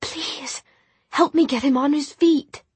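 high, hushed, urgent plea; whispered plea. A narrator's reading. Tessa begging Jem to help lift Will, urgent whisper. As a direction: beg under the breath, pitched high. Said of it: "Tessa whispered".

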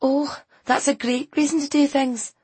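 high, scornful retort; mock it like an exasperated teen; sarcastic teenager. A narrator's reading. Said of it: "rolling her eyes".

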